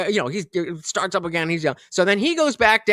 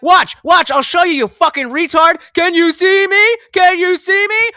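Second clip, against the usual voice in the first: high, loud, exasperated demonstration; angry yelling; 11 semitones higher, 8 dB louder than usual. Retold or imitated. Dog walker behind a dumpster to stranger, exasperated yelling. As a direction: yell it to prove your point. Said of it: "like, this is me".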